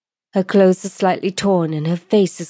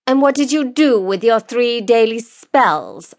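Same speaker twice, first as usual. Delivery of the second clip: raised pitch, pointed questioning; accusing; 5 semitones higher than usual. Acted. Lord cross-examining the woman in court, accusingly. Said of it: "asks Lord Vandel accusingly".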